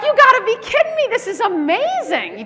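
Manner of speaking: high, fast burst of excitement slowing on amazing; thrilled disbelief. Retold or imitated. Telling the apartment manager he can't believe the timing, excited. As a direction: blurt it out like you can't believe your luck. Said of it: "I was like".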